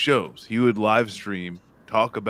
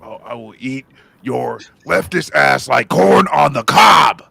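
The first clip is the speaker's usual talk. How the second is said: shouted drill sergeant rant, loud; loud gung-ho soldier; 3 semitones higher, 12 dB louder than usual. Retold or imitated. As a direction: roar it like a Marine on parade. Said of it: "[name] does the good Alex Jones".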